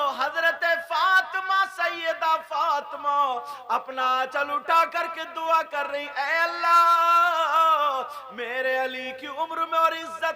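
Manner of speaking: loud, sustained sermon delivery over music; loud, impassioned preacher retelling a tale to the gathering; preaching at full force. Acted. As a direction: hold the crowd with a loud preaching flow. Preacher resumes impassioned story.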